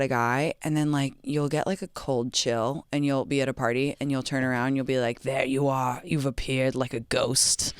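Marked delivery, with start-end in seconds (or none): 5.2-7.7 low, slow eerie ghost voice. Acted. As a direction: drift the words out like a ghost. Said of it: "I like your ghost voice".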